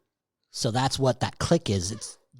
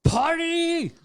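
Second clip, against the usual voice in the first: high, drawn-out "party!" shout; gleeful party cheer. Acted. Joking about letting it all go, gleeful cheer. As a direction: sing out a long, high-pitched celebratory cry.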